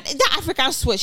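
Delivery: usual speaking voice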